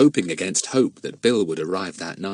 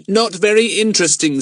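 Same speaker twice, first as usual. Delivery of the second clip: higher, louder schoolboy scoff; dismissive; 11 semitones higher, 6 dB louder than usual. Acted. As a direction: brush it off in a raised boyish voice. Jack dismisses a find, laughing.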